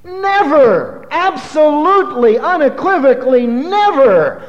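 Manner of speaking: fiery pulpit proclamation; preaching at full force. Real, not acted. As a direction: thunder the denial to the congregation. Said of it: "That's what Jesus said".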